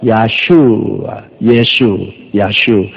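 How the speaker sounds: 야슈아, 예수, 야슈 with the SHU long-drawn; drawn-out comparison. Shown as a demonstration. Preacher comparing Hebrew with Korean, names sounded out slowly. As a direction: stretch each name slowly to show the likeness. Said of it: "우리 한국말이 제일 그 이름에 가깝습니다".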